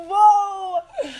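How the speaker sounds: a high, loud, laughing cry of awe; awed whoa. Retold or imitated. Retelling her reaction to Bali, awed loud cry. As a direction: blurt it out overwhelmed, half laughing. Stunned by Bali's answer.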